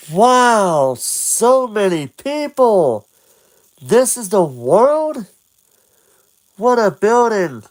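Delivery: high awed exclamations; amazed. Acted. Link sees the town, high and amazed. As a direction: gasp it out in wonder. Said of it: "And he says".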